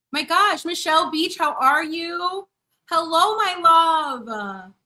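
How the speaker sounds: high, loud, slow gushing hello; excited greeting. Real, not acted. Greeting viewer Michelle Beach in chat, high loud gushing hello.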